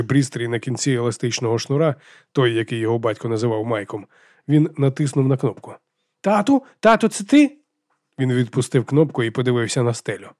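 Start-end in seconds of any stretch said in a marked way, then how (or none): 6.2-7.6 високий схвильований запит сина; high, hopeful child calling Dad; хлопчик кличе батька. A narrator's reading. (син кличе тата по рації, високо й запитально)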